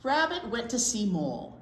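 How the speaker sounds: ordinary delivery, nothing marked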